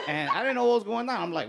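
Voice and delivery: ordinary delivery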